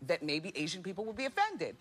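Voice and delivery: ordinary talk, nothing marked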